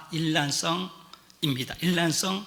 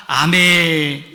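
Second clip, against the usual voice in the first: slow, loud, stretched-out amen; drawn-out amen; 8 dB louder, much slower than usual. Real, not acted. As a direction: hold the amen long and strong. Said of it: "congregational amen after scripture".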